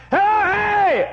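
very high, loud holler; shouted greeting in the night; calling out. Acted. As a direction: wake the whole house. Calling out again at the door.

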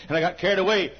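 ordinary talk, nothing marked

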